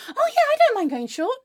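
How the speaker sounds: high, sing-song politeness; falsely breezy agreement. Acted. Client giving in to hairdresser, falsely breezy agreement.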